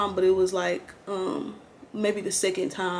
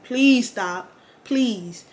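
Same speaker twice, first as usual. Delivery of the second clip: exasperated plea; 2 semitones higher, 5 dB louder, much slower than usual. Retold or imitated. Begging reckless drivers to slow.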